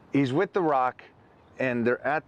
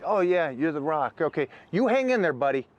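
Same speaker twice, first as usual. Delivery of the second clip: mock cheerful clerk; 7 semitones higher than usual. Mocking clerk encouraging The Rock.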